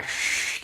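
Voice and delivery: sustained hushing "sh"; sh sound. Shown as a demonstration. Teacher isolating the sound for viewers, long sh. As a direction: hold "sh" like a hush. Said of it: "S H gitu loh".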